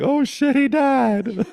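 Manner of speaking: imitating a high, loud panicked cry; panicked friends. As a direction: shriek it like friends who fear the worst. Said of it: "my sweet friends ... were like".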